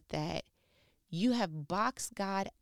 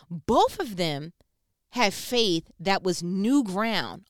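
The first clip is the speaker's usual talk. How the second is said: both of them had faith that was new ground said slow and loud; slow emphasis; 7 dB louder, much slower than usual. Real, not acted. Preacher stressing a key point to listener, slow and loud. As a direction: lean on each word slowly. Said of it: "preacher slows to stress point".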